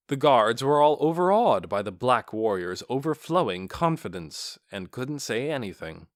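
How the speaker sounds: plain reading voice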